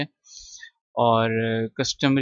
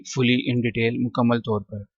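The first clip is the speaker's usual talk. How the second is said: ordinary talk, nothing marked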